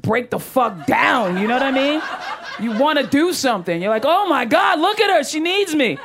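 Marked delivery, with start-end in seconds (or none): 4.0-6.0 high-pitched, gushing mock concern; mock alarmed sympathy. Comic mimics onlookers fretting over a crying lady, mock alarmed. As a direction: play an over-the-top panicked rescuer.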